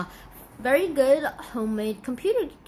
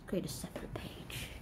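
whisper; 9 semitones lower, 17 dB quieter than usual. Real, not acted. Aside while editing.

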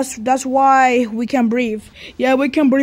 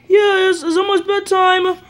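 pretend son's protest; 6 semitones higher, 3 dB louder than usual. Son figure answers dad at bedtime.

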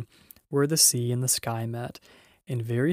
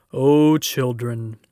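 loud, slow, drawn-out lion's call; exultant call; 9 dB louder, slower than usual. A narrator's reading. Lion calling to the children, exultant and loud. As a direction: call out grandly, stretching the words.

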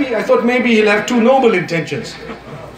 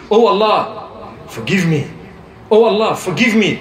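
slow, drawn-out "Oh Allah, forgive me"; said slowly. Shown as a demonstration. Preacher showing listeners how to plead slowly, slow and deliberate. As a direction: stretch each word out, unhurried. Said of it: "Say it slowly".

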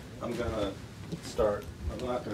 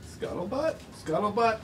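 high-pitched, building to a loud drawn-out call; playful relishing; 11 semitones higher, 5 dB louder, slower than usual. Shown as a demonstration. Author shows children a fun word, playful and building. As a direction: savor the silly word, bigger each time. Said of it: "it's fun to say".

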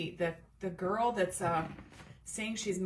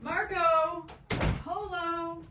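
loud call to another room, high and raised; 9 semitones higher, 5 dB louder, much slower than usual. Real, not acted. Mom calling Marco from the other room, loud and high. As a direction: call out across the house to be heard.

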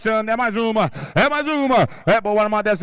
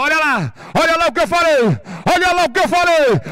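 loud, high excited call; excited shout; 4 semitones higher, 4 dB louder than usual. Real, not acted. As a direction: shout it out triumphantly. Announcer excited by catch.

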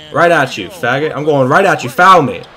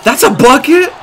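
high, loud indignant question; can't believe that counted; outraged disbelief; 10 semitones higher, 7 dB louder than usual. Real, not acted. Outraged at opponent's score.

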